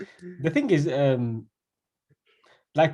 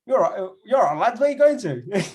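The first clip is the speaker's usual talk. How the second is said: higher, louder posh London voice; mock-posh London accent, pitched up; posh English accent; 6 semitones higher, 4 dB louder than usual. Friend guessing how the cab driver spoke, posh London accent. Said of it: "that's, like, posh".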